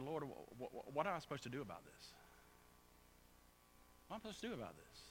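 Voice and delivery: softly, haltingly ask God for direction; quiet, searching plea, retold; hushed retold prayer. Preacher retells asking the Lord what to do, hushed.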